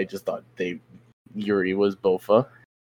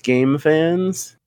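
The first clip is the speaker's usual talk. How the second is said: slow, stretched, raised "game fans" as if in air quotes; scare-quoted game fans; 8 semitones higher than usual. Shown as a demonstration. Mocking the so-called Pokemon game fans, slow air-quote stress. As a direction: drag out "game fans" sarcastically, pitch up.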